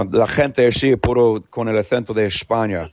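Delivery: Spanish as spoken in Spain; Castilian accent. Shown as a demonstration. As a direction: demonstrate a pure Castilian accent. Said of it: "a Castilian accent".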